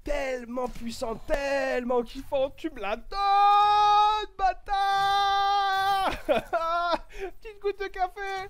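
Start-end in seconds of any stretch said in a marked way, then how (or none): 0.0-4.7 climbing higher and louder, dragged out slowly; excited pleading. Real, not acted. Begging the game for a gun. 4.7-7.4 high excited burst of laughter. Real, not acted. Laughs after begging.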